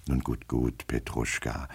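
soft, low, soothing voice; placating. Acted. Master pacifying his drunken servant, soft and soothing. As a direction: drop the voice and pacify him.

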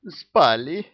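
guilty little confession; guilty girl. A narrator's reading. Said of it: "виновато шмыгнула носом одна из девиц".

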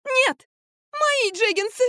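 very high, wailing squeal; panicked protest. A narrator's reading. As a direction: squeal to protect your treasure. Марфа protests as cutting threatens. (отчаянный тонкий вопль)

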